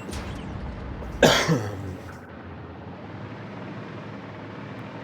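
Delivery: long hacking cough; coughing fit. Real, not acted. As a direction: cough hard several times over. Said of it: "I apologize for my coughing".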